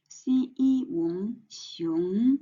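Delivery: "xi yi wong" in slow separate syllables, "xiong" gliding up; syllable-by-syllable pronunciation. Shown as a demonstration. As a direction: model "xi yi wong xiong" slowly for learners. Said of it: "We say in Chinese".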